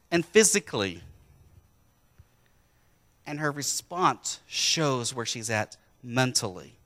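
ordinary talk, nothing marked

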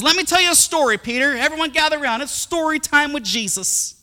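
high, loud mock story-time call; storyteller calling listeners round. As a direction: gather everyone in with a hammed-up, high voice. Jesus gathers disciples for story time.

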